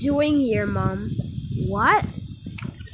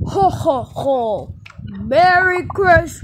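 loud, slow ho ho ho; Santa voice; 5 semitones higher, 7 dB louder, slower than usual. Pretend. Kid playing Santa booms a loud merry Christmas. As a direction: boom it out like Santa.

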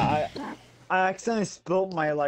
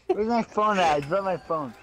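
normal talking voice

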